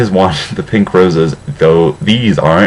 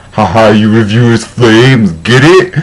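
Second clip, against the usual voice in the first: teasing, higher and louder; mocking aside; 4 semitones higher, 5 dB louder than usual. Narrator taunting the reviewers, mocking and loud. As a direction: jab at the critics with a smirk.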